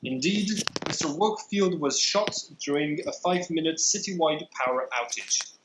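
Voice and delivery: ordinary talk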